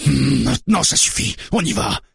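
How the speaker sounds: high, angry, impatient outburst; growling. A narrator's reading. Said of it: "grogne le barbu".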